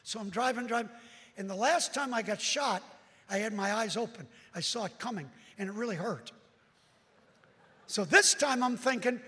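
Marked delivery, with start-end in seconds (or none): none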